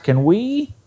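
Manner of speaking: "Can we" rising high at the end; questioning intonation. Shown as a demonstration. Teacher shows a recognizable question, "we" lifted high. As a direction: swing "we" upward as a question. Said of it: "Can we is recognized".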